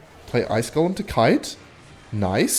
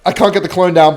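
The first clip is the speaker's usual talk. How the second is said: normal talking voice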